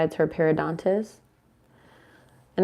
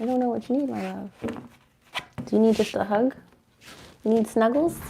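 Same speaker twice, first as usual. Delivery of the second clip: doting coo; 4 semitones higher, 4 dB quieter than usual. Real, not acted. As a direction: coax the dog toward a hug. Cooing to her dog.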